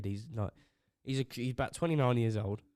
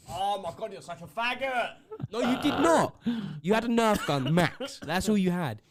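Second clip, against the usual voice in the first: high, loud scoffing retort; higher, louder mocking put-down; 6 semitones higher, 6 dB louder than usual. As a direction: laugh off his gun story.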